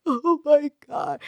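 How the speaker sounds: high-pitched, drawn-out mock wail; imitated bawling. Speaker retelling being overwhelmed by energy, imitated high bawling. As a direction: act out crying uncontrollably. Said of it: "I'm bawling".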